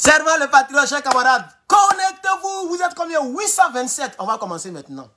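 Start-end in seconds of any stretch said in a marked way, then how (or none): 0.1-4.1 announcer's call. Real, not acted. Streamer calls comrades to connect, high announcer's call. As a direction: call the audience in on a high held pitch. Host's opening call to live viewers.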